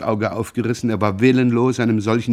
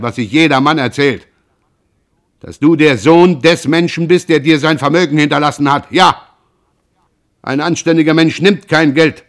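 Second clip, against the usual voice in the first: loud and much higher, outraged; furious shout; 5 semitones higher, 8 dB louder than usual. Acted. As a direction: fling the insult loudly. Pierre shouts the rumour aloud.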